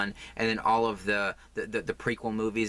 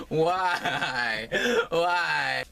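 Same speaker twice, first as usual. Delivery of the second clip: high, louder comic wail of "Why?"; mock wailing; 8 semitones higher, 4 dB louder than usual. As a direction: howl it like a sitcom tragedy. Hammed-up despair over yarn.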